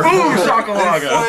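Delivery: hyped announcer call, laughing; slow drawn-out hype shout; announcer catchphrase. Retold or imitated. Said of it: "his line is like".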